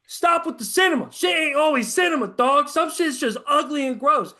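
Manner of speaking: loud, high rant against hype; shouting down people who call every game cinema; frustrated shout. Real, not acted. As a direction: yell it like you're fed up. Ranting about football hype.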